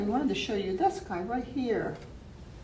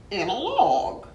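groundhog voice; 7 dB louder than usual. Acted. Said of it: "said the groundhog".

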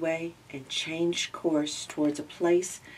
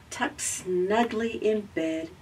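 plain reading voice

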